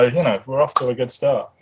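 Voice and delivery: normal talking voice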